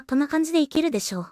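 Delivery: normal talking voice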